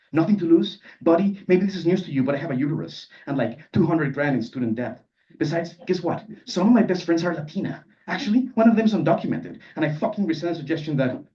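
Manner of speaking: mild acted indignation, building as it goes; indignant rebuttal. Canonizer telling off a classmate, indignant. Canonizer angrily rebukes the narrator.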